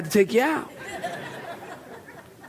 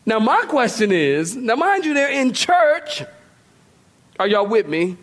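ordinary talk

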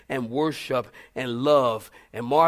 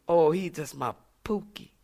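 mock sweet. Pet-name voice.

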